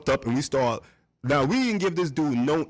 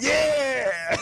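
long, high hollered cheer; imitated ad-lib yell. Rapper runs back into the control room, high imitated whoop. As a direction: let it ring out high and drawn out.

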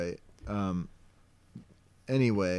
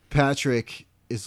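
normal talking voice

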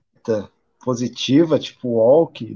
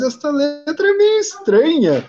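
surprised realization; 10 semitones higher than usual. Retold or imitated. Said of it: "você vê".

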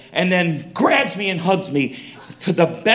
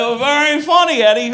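Jimmy Stewart impression; 9 semitones higher, 4 dB louder than usual. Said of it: "and he's like".

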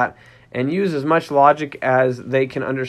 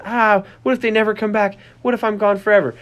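panicked puppy; 7 semitones higher than usual. Retold or imitated. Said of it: "they're saying".

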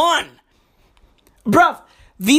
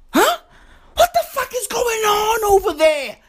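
exasperated outcry; 9 semitones higher than usual. Real, not acted. Exasperated outburst at the WHO.

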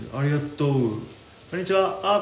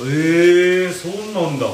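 loud, raised, drawn-out reaction; impressed surprise; 4 semitones higher, 7 dB louder, slower than usual. Real, not acted. Streamer hearing the word's origin, impressed surprise. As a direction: react loudly to news to you.

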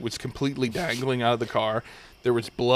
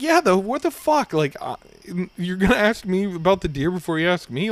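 high-pitched, indignant comeback; exasperated retort; 7 semitones higher, 3 dB louder than usual. Retold or imitated. Driver answering the trooper's deer question, exasperated. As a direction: act out disbelief at being asked the wrong thing.

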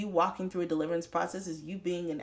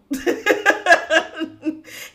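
loud high burst of laughter; real laugh; big laugh; 10 semitones higher, 10 dB louder than usual. Real, not acted. Laughing at her own joke.

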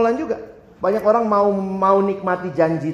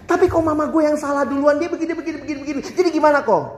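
put-on whiny Jakarta teen; 8 semitones higher, faster than usual. Teenager argues with the pastor that mom started it, high and whiny. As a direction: whine and insist it's mom's fault.

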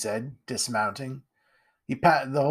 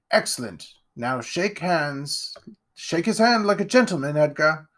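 higher, grand aristocrat voice; pompous lord; 5 semitones higher than usual. Acted. Lord Ironwood greeting Jack, pompous and grand. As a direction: puff yourself up like a self-satisfied lord.

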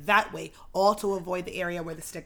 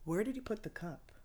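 quiet, dropping into a near whisper; hushed aside; 15 dB quieter than usual. Retold or imitated. Retold private word with cup shuffler.

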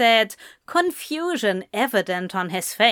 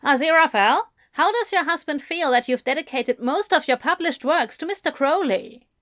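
higher-pitched press-conference questioner; probing journalist; 6 semitones higher, faster than usual. Acted. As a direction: put a pointed question in a raised pitch. Journalist asking a pointed question.

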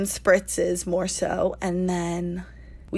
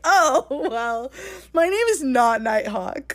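giggling confession, a bit higher; laughing admission; 5 semitones higher than usual. Retold or imitated. Admitting to Wolfgang her fake name, laughing. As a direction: own up while laughing.

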